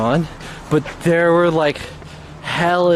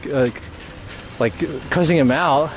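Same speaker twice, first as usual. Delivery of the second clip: ordinary talk, nothing marked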